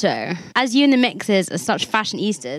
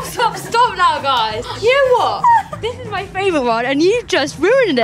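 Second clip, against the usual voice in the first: mock outrage; 9 semitones higher than usual. Host's favourite jumper ruined.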